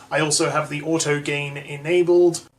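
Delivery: ordinary talk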